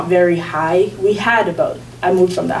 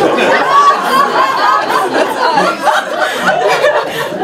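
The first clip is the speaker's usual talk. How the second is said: long, very high fit of laughter; helpless high-pitched laughing; real laughter. Real, not acted. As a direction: lose it at your own punchline. Cracking up at own punchline.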